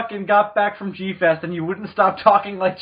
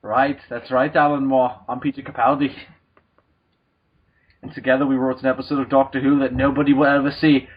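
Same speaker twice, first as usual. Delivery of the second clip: put-on Scottish accent; 6 semitones lower than usual. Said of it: "you're not gonna stop that accent".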